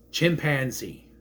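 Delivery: ordinary delivery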